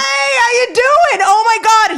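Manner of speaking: a dad spotting a rock star; imitating excited dad. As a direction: gush like a starstruck dad. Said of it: "He'd be like".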